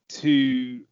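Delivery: ordinary talk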